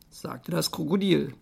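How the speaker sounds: usual speaking voice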